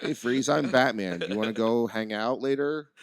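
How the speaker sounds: nonchalant. Retold or imitated. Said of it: "that's how he says it".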